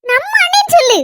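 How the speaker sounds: high-pitched, insistent correction; indignant correction. Acted. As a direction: correct your sister, pitch leaping up. Sister corrects her sister's wording indignantly.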